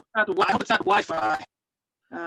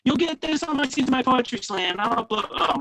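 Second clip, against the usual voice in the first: muffled voice; 7 semitones higher, 4 dB louder than usual. Real, not acted. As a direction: voice muffled as if covered. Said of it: "muffled voice".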